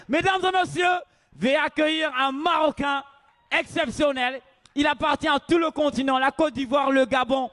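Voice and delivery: loud, high stage introduction; announcer voice. Acted. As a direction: hype the crowd for the act. MC introducing comedian to crowd.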